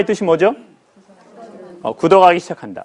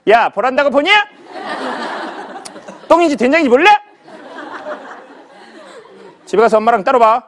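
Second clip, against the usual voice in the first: a higher, teasing mock scold of a mom; mother scolding; 6 semitones higher than usual. 딸에게 핀잔 주는 엄마, 장난스러운 꾸중. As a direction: playfully tell the daughter off. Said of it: "지금 옆에서 엄마 눈이".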